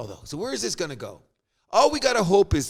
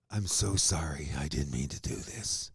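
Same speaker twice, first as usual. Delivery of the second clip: much lower, much softer, slow apology; mock sheepish apology; 9 dB quieter, slower than usual. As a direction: mumble the sorry like a sheepish spokesman. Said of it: "and this guy said".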